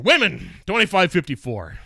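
loud, high announcement; reading out results. Retold or imitated. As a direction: announce the category like a boss reading scores. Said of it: "starts reading".

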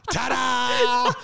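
high, laughing magician's ta-da; showman's reveal. Acted. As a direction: draw out the mock-triumphant reveal. Repeats the mock magic reveal.

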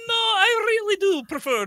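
high little character voice, laughing; little Italian guy pleading; tiny squeaky voice. Acted. Tiny voice refusing.

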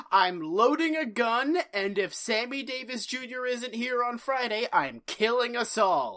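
hammed-up, high-pitched zeal; over-committed mock threat. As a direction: declare it with absurd total commitment. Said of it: "That's the level of commitment".

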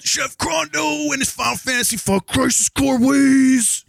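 high shouting wrestling heel voice; wrestling promo. Pretend. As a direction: roar it like a ring announcer hyping a fight. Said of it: "I need you to make a wrestling promo now".

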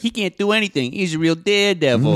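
sarcastic bully. Retold or imitated. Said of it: "smart and sarcastic with it".

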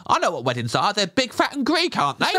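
lightly mocking know-it-all; a hint of a clueless American. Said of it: "walking around going".

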